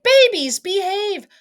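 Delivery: high, loud call to naughty babies; crying out. Acted. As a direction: shout a warning to the babies. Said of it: "You cried".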